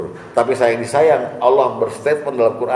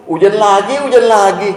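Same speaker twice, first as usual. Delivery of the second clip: high, loud grumbling about the rain; whiny complaint; 8 semitones higher, 6 dB louder than usual. Retold or imitated. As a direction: grumble it like a fed-up man. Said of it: "Kata Bapak". (keluhan merengek yang dibuat-buat, lebih tinggi dan keras)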